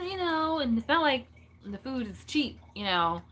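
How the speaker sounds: usual speaking voice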